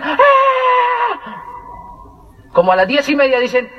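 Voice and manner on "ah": high, shouted mock crowd cheer; crowd roar. Narrator imitating the excited crowd, shouted cheer. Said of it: "Y todos decían".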